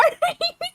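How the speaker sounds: high laugh. Real, not acted. Laughs after the cheer.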